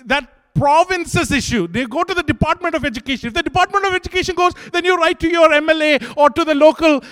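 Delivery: high, loud, building exhortation; fiery preaching. Acted. Preacher urging the congregation to fight for justice, fiery and loud.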